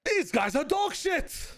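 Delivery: a botched go at someone's voice, drawn out; failed TMV impression. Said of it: "That does not sound anything like T M V".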